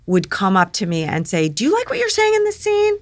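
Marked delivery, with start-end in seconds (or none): 1.5-3.0 much higher-pitched quoted question; imitated friendly query. Writer checking with an actress, high-voiced query. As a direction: ask it up high, mimicking someone.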